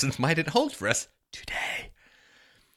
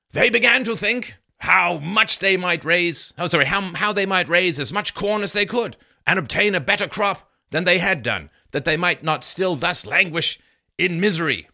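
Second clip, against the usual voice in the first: loud, higher-pitched declamation; declaimed historical quote; 7 semitones higher, 6 dB louder than usual. Acted. Reading Bradford's account to listeners, loudly declaimed.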